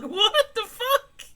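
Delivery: very high, slow, laughing disbelief; shocked laughing outburst. Retold or imitated. Reacting to the friend's confession, shocked and laughing. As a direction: stretch it out through a laugh. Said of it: "was like".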